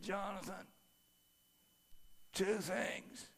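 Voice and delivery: a weak man after heart surgery, barely there; dad's little voice. Retold or imitated. Frail father speaking from hospital bed, tiny soft voice. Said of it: "he had this little voice".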